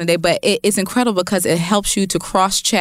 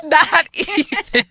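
laughing; 10 semitones higher, much slower than usual. Real, not acted. Laughing at Spirit joke.

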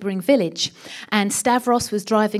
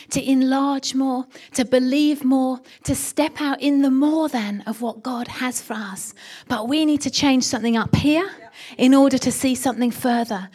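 normal talking voice

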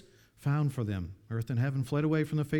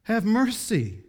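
higher, louder, slow cry for mercy; pleading cry; 7 semitones higher, 5 dB louder, slower than usual. Acted. Preacher voicing the rich man crying out for mercy, loud and slow. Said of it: "He cried for mercy".

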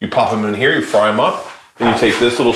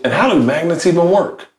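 mock clueless rapper voice; a light dumb wondering quote; [name] impression; 7 semitones higher than usual. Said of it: "that line by [name]".

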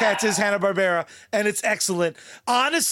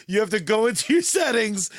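usual speaking voice

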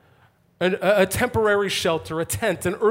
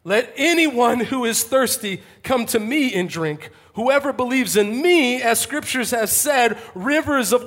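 raised, slow proclamation; loud voice; 4 semitones higher, slower than usual. Acted. Jesus inviting the thirsty at the festival, loud voice. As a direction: proclaim it slowly to a whole crowd. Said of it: "he says in a loud voice".

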